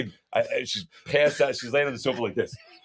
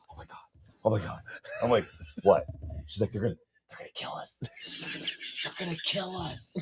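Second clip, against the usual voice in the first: hushed mock-paranoid whisper; imitated paranoia; 10 dB quieter than usual. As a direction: whisper it like you're imitating someone terrified. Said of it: "getting all paranoid".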